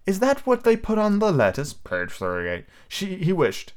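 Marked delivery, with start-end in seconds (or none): 0.0-1.7 put-on high girl voice. Wendy voiced.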